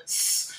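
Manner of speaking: soft drawn-out cobra hiss; snake hiss. Acted. Imitates the cobra just named.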